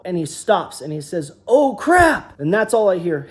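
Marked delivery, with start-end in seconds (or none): 1.5-2.4 a sharp, higher, louder "Oh, crap" as the truck slides; playing the startled hauler mid-crash; imitated sudden alarm.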